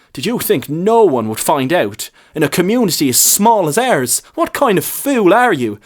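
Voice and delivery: rising to an angry rebuke; harsh. A narrator's reading. Priest angrily scolding the lad in the confessional. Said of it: "spoke in a harsh and measured voice".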